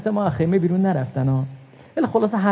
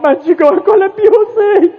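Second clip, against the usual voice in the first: high crying plea; wailing cry; 14 semitones higher, 6 dB louder than usual. Acted. Preacher voices Zainab, high wailing plea without Hussein. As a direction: cry it out to the sky.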